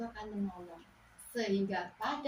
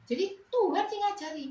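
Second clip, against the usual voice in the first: exclaiming; 9 semitones higher, 7 dB louder than usual. Real, not acted. Preacher exclaims God taught.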